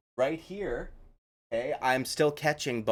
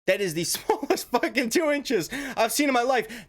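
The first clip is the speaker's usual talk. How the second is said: laughing disbelief; 10 semitones higher than usual. Real, not acted. Laughs at the claim.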